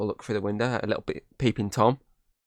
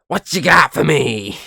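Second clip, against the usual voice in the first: creepy dealer voice; 6 semitones higher, 10 dB louder, slower than usual. Retold or imitated.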